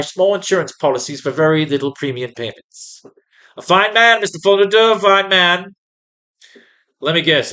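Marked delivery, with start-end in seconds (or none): 3.6-5.7 high and louder, gushing with rising ends; a naive granny singing her swindler's praises; old lady praise. Pretend.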